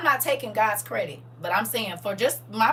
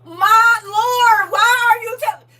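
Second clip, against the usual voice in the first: high, loud mock wail of dismay; a hammed-up inner cry to the Lord; high comic wail, slow on my Lord; inner panic; 13 semitones higher, 12 dB louder, much slower than usual. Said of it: "in your mind, you like".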